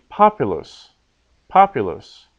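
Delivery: POpulus, first syllable stressed; stress third from last. Shown as a demonstration. Teacher showing the normal word, populus with first-syllable stress. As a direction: hit PO in populus and let the rest fall. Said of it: "so the accent is on the third".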